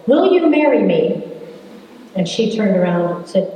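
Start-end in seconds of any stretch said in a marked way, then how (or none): 0.1-1.2 mock yell. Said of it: "he yells out the window".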